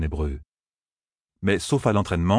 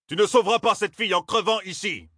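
much higher, urgent shout; 13 semitones higher than usual. A narrator's reading. Ingtar to Rand, a much higher urgent shout. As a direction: yell to drag him away. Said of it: "cria Ingtar".

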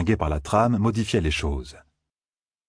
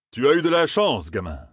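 a lightly voiced, higher, dry old gleeman; slightly higher, wry and knowing; Tom's voice; 6 semitones higher than usual. A narrator's reading. Gleeman Tom to young Matt, lightly voiced and wry.

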